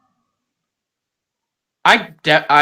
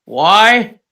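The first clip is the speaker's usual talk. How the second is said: mock plea; 4 semitones higher, 5 dB louder, much slower than usual. Mock question put to God.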